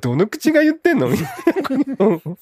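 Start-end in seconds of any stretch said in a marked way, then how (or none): 0.0-1.1 high, disbelieving jab; incredulous retort. Retold or imitated. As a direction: scoff at the nerve of it. Quoting Takagi's retort.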